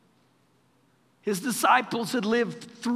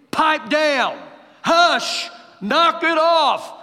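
loud, raised command to be silent; sharp rebuke; 8 semitones higher, 7 dB louder, much slower than usual. Acted. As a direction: shout down the storm. Jesus rebuking the storm.

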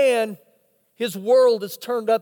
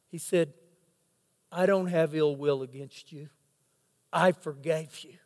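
tender forgiveness; 6 semitones lower, 4 dB quieter than usual. Retold or imitated. Son tells killer he forgave.

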